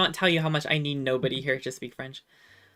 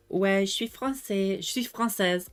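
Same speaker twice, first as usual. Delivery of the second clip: lightly put-on French girl, higher pitch; pretend French girl; 4 semitones higher than usual. Streamer fooling people who don't speak French, lightly playing French. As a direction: casually pose as a native French speaker. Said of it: "I'll be like".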